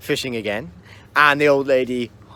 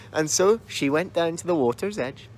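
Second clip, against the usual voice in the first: ordinary delivery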